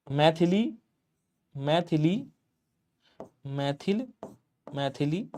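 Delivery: मैथिली drawn out syllable by syllable, each take rising; slow spelling out. Shown as a demonstration. Teacher sounding out मैथिली for students, syllable by syllable. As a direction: sound out मैथिली slowly as if writing it. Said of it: "मैथिली Sharan ऐसे होगा".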